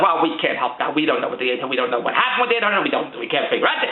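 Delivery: lightly voiced bank clerk. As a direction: mildly imitate the unhelpful teller brushing him off. Retold bank clerk refusing again.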